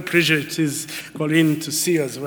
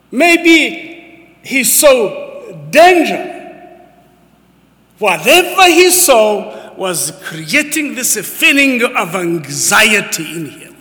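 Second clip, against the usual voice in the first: preaching; 6 semitones higher, 6 dB louder, slower than usual. Acted. Preaching at full force.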